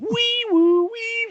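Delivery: high siren wee woo; siren imitation.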